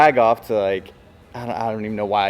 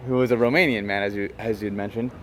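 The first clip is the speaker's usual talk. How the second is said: ordinary talk, nothing marked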